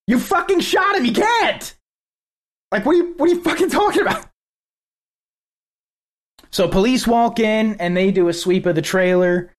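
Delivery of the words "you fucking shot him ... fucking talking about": high-pitched imitation of a yelling woman; mock a woman's outraged yell, pitched way up without much volume. Host imitating the woman yelling out the door at police, high mock yell. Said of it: "The woman is yelling out the door".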